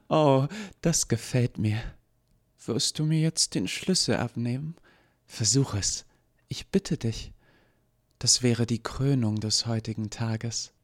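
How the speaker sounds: high, teasing cruel voice; taunting villainess. Acted. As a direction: dare her to take the key. Lucy taunts Eva.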